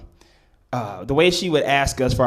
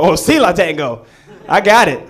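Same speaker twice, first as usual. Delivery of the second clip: eager bluff; 4 semitones higher, 6 dB louder than usual. Retold or imitated. Kid bluffing to teacher.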